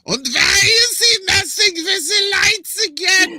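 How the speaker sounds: put-on shouted high German accent, slow and furious; an irate German lady shouting about the lights. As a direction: bellow it like an angry German neighbor. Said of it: "that's my irate German voice".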